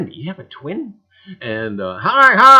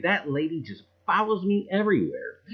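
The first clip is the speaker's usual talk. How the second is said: ordinary talk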